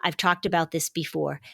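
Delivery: usual speaking voice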